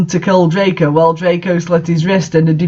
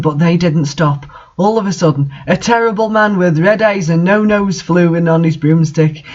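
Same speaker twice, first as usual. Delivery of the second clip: normal talking voice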